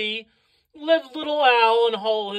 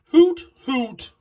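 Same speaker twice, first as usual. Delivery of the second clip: loud little owl hooting; two separate, punchy owl hoots; happy owl hoot; 3 semitones higher, 6 dB louder than usual. A narrator's reading. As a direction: hoot it out happily like a storybook owl. Owl hoots happily at story's end.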